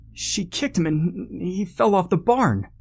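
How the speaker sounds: very high, frantic report; panicked stammering. A narrator's reading. Shaken friend explains the fall, panicky stammer. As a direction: blurt it in a high, anxious voice. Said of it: "Peter stammered".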